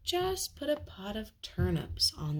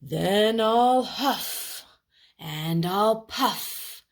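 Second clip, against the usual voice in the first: very slow, loud wolf threat; wolf taunting the pig before blowing; gloating wolf; 6 semitones higher, 6 dB louder, much slower than usual. Acted. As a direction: draw out each threat with relish. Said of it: "laughed the wolf".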